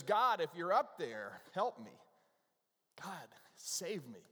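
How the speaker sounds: loud start fading to a slow plea; a storyteller's mock-desperate prayer, drawn out. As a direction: plead slowly to heaven, playing it up. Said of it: "in desperation, he cries out".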